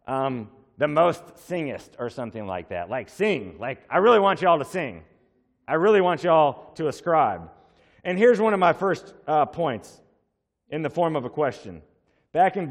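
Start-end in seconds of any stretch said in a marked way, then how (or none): 3.6-7.5 louder, stress on really; I really want y'all to ascribe, pushed hard; preacher urging the congregation; emphatic plea. Shown as a demonstration. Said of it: "greatly emphasizing something".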